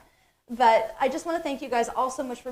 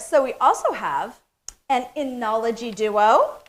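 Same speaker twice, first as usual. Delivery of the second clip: ordinary talk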